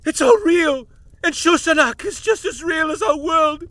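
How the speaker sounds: ecstatic shout. Acted. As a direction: shout the revelation with stress on just. Professor's ecstatic revelation.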